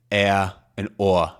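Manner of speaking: air, and or im Boston-Akzent, langsam und anders gefärbt; Boston accent vowels. Shown as a demonstration. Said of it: "and then there's normal I".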